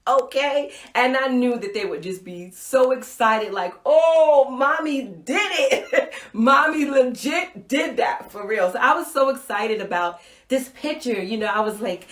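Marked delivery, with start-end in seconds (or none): 3.8-8.7 loud, laughing, proud celebration; laughing cheer. Retold or imitated. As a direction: cheer as if your kids are proud. Imagining her kids cheering her.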